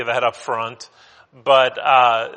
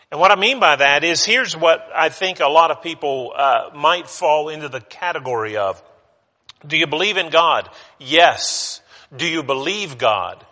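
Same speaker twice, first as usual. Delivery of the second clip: usual speaking voice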